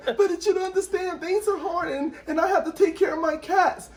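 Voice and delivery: high, whiny excuse-making voice; whiny patient. Retold or imitated. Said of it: "And they're like".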